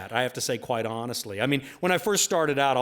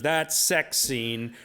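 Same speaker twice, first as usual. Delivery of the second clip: slow, hesitant fan; much slower than usual. Retold or imitated. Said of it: "she goes".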